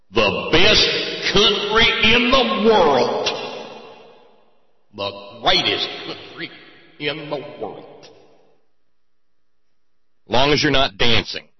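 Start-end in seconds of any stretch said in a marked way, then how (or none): 0.1-7.9 slow, high, loud mock pride; drawn-out sarcastic boast; sarcastic proclamation. Real, not acted. Host mocking America, slow sarcastic proud proclamation.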